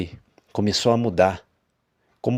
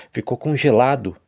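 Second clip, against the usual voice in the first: ordinary delivery, nothing marked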